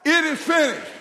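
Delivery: loud, high emphatic answer; ringing preacher's declaration. Shown as a demonstration. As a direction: answer the prompt boldly. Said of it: "you know what we need to say".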